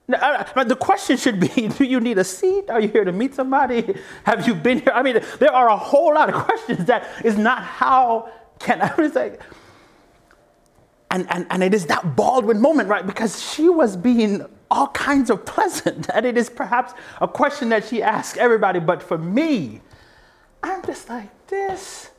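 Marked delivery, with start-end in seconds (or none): 0.1-12.9 high, loud, laughing disbelief; laughing exasperation. Real, not acted. To the audience, mocking the host's question, laughing exasperation. Laughing rant about the host. 20.6-22.1 high, squeezed, strained pitch; strained high squeak. Real, not acted. Describing her rage at the host, strained high squeak. Groping for the feeling.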